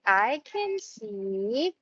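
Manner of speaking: slow, stretched model sentence; slow modelled sentence. Shown as a demonstration. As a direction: speak it slowly for the child to repeat. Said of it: "you can say".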